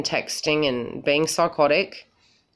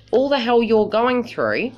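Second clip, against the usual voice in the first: ordinary talk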